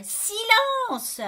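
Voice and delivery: high, loud, drawn-out shout; exasperated yell; yelling for quiet. A narrator's reading. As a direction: howl it to hush the household. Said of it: "je hurle".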